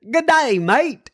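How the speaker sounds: high, cheery Australian "Good day, mate"; Australian accent. Retold or imitated. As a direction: greet like a jovial Aussie. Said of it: "here's another Aussie".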